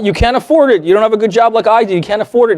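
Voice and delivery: big brother sneering as the client hears it; condescending dig. Retold or imitated. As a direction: sneer that he can't afford it. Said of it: "That's how he heard it".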